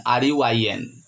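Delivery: R U I N spelled letter by letter, each letter separate; spelling out. Shown as a demonstration. Teacher spelling R U I N for students, letter by letter. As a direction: spell out R U I N for the class. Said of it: "spells ruin letter by letter".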